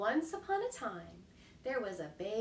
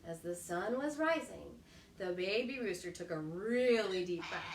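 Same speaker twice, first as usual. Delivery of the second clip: plain reading voice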